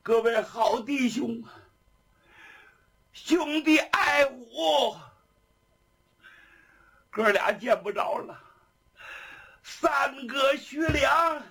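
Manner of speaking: high, drawn-out wail; tearful farewell. A narrator's reading. Weeping prisoner calls farewell to his sworn brothers, tearful. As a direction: cry out to absent brothers. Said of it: "眼泪吧嗒吧嗒下来".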